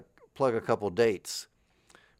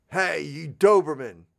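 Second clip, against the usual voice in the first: louder, slow drill-sergeant call; sergeant barking; 6 semitones higher, 7 dB louder than usual. Pretend. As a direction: bark at your imaginary private. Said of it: "and I say".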